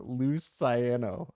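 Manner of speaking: Luciano slowly with a long S, looss-eye-anno; deliberate mispronunciation. Shown as a demonstration. Host teasingly giving up, Luciano as looss-eye-anno. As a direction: draw out the S in Luciano. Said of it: "I give up".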